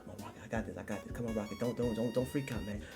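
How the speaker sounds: very soft, low self-talk; composing himself. Pretend. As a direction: talk yourself down under your breath. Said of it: "Let me compose myself".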